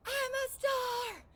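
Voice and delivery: high, slow, stretched-out scream. Shown as a demonstration. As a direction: belt it out like a cheer. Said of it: "extra points again if you scream".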